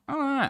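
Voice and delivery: high, drawn-out imitation of a cat's call; cat yowl imitation. Trying to show the sound a cat makes, imitated yowl. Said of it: "the sound a cat makes".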